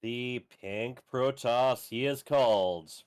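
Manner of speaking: slow, drawn-out announcer intro; fighting game announcer. Shown as a demonstration. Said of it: "announcer voice going".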